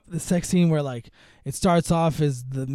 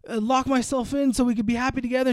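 mock pleading; 7 semitones higher than usual. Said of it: "he's like".

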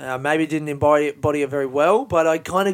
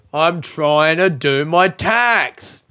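slow grumble; 6 dB louder, much slower than usual. Real, not acted. Groaning about doing taxes.

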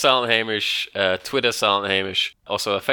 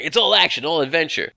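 hard-sell ad voice; 6 semitones higher than usual. Pretend.

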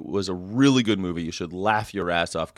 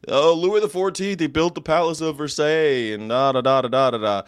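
high, mocking sing-along praise; mock gushing; 5 semitones higher, 4 dB louder than usual. Host mimicking fans of the Sun King, mock gushing. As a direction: gush mockingly, trailing into da-da-das.

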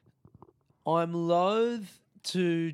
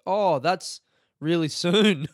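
slow, loud, higher dopey voice; mocking clueless lady; 4 semitones higher, 7 dB louder, slower than usual. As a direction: ham up the airline clerk's vacant surprise.

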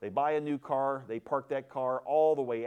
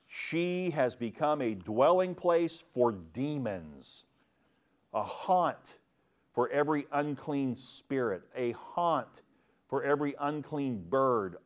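solemn scripture reading; 3 semitones higher than usual. Acted. Reads scripture with solemn cadence.

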